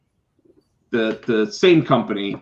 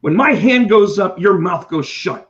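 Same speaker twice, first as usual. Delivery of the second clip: stern teacher; 6 semitones higher, 4 dB louder than usual. Retold or imitated. Teacher laying down the rule to a class, loud and stern. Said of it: "that's what teachers do".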